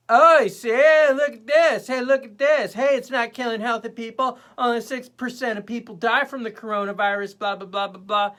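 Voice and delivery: loud, high hammed-up crowing; mocking idiot Twitter. Idiot Twitter crows about six percent, loud mocking voice. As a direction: crow like an online know-it-all, high and loud. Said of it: "idiot Twitter was just like".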